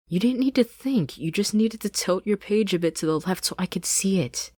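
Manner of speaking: high, loud scoffing Sasha voice; furious Sasha. Acted. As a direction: snap back at her friend. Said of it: "Sasha scoffed".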